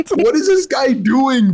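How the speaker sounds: very high, drawn-out mock disbelief; incredulous outburst. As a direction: squeal it slowly, half laughing in bafflement. Retold bewilderment at the scream.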